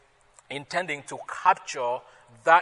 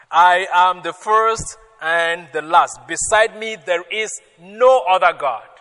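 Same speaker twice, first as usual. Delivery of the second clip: boastful God; 3 semitones higher, 8 dB louder than usual. Acted. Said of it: "the boastfulness of our God".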